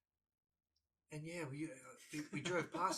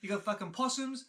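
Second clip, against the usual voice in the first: higher, exasperated listing as an imitated dad; short-tempered dad; 8 semitones higher, 4 dB louder than usual. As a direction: act out dad's irritated rant. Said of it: "short temper of him goes".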